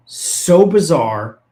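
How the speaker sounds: so bizarre, drawn out very slowly; stress on so bizarre. Real, not acted. Host telling co-host the outburst was bizarre, slow stressed disbelief.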